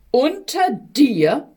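slow, drawn-out indignant question. A narrator's reading. Death scoffs at Sickness from the darkest hole, indignant. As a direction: scoff in disbelief, slowly. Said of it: "rief da der Tod, empört".

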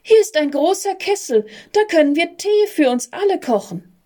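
put-on a girl's high, delighted cry; a light, high little girl calling out; excited girl. A narrator's reading. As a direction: squeal with joy at the find. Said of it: "rief ein Mädchen".

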